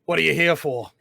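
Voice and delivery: loose fantasy Cockney, slightly Australian; mock Cockney drifting toward Australian; Cockney accent. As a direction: attempt a light Cockney. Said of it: "Anyway, back to Cockney".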